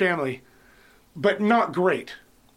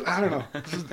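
ordinary delivery

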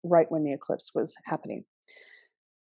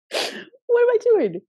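What am I doing? pitched way up, slow and loud; exaggerated disbelief; 5 dB louder, slower than usual. Host repeats the dream question in high mock disbelief. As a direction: stretch it out high, mock bewildered. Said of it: "repeats the question dramatically".

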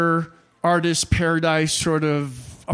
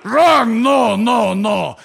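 high, loud shouting of no, no, wrong; alarmed passenger bellowing at the driver; frantic shout; 6 semitones higher, 9 dB louder, slower than usual. Retold or imitated. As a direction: yell to stop the driver. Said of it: "the gentleman sitting next to me goes".

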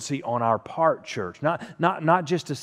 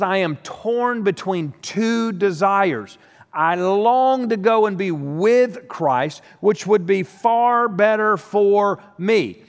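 slow, raised, earnest reading of the verse; scripture reading; 6 semitones higher, 5 dB louder, slower than usual. Acted. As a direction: read it like the living word, slow and full. Reads scripture aloud.

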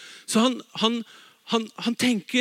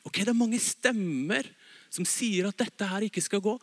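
usual speaking voice

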